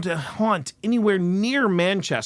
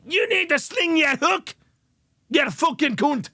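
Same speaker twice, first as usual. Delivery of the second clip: Glasgow ghost shouting; 11 semitones higher than usual. Retold or imitated. Glasgow ghost throws out the hunter, shouted Glaswegian rant. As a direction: bellow like an angry Glasgow ghost guarding his whisky cask. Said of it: "Glasgow would be intense".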